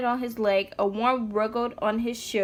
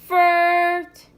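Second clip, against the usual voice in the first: loud, high blat like a bad tuba note; tuba fart noise; 8 semitones higher, 9 dB louder than usual. Shown as a demonstration. Mom sounding the tuba so her son can answer, loud blat. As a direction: blurt it like a sour horn. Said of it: "when I say again, you say".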